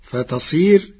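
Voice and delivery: ordinary talk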